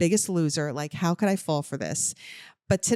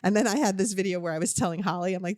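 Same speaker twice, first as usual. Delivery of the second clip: usual speaking voice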